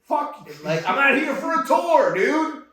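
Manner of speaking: high, loud furious tirade; retold angry rant. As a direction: let the rage climb and yell it. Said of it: "but I was mad".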